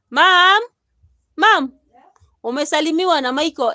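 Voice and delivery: loud, high call to someone far off; shouting for mama. Real, not acted. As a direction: yell to get mama's attention. Shouting for mother.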